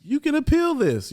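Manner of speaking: squeaky high echo of the crackhead; high mock crackhead voice. [name] quoting the crackhead's advice, high and squeaky. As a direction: pitch way up, mimicking his advice. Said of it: "I'm like".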